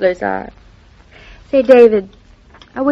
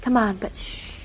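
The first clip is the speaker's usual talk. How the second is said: hushed; 14 dB quieter than usual. Acted. Daughter hushing David while Mama is still asleep, hushed. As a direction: keep your voice down to a near whisper.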